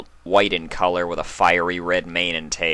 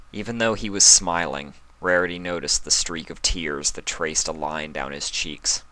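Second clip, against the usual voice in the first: ordinary delivery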